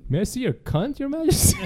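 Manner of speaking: high, laughing mock-British echo; imitated British accent. Host mocks guest's sentence choice, British accent. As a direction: parrot it in high fake British, cracking up.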